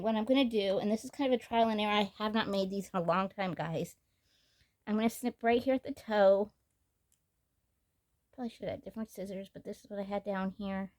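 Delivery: ordinary talk, nothing marked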